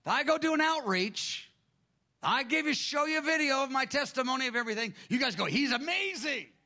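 high, animated retelling of Chan; imitating Francis Chan. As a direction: voice Chan's talk from the conference stage high and lively. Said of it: "he goes".

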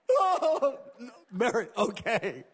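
laughing gently while easing her off; a fond chuckle through the words; laughing fondly. Acted. Jesus gently easing Mary's hug off, laughing fondly.

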